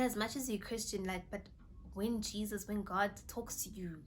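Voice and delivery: normal talking voice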